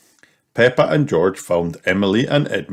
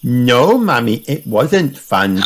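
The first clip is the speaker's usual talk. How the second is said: put-on higher little-girl piglet voice; raised, childlike reply; little Peppa; 4 semitones higher, slower than usual. A narrator's reading. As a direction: answer mummy like a small child. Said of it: "replied Peppa".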